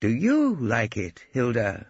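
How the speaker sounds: slow, courtly question; gallant. A narrator's reading. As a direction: woo her politely. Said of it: "in a way I can only describe as gallant".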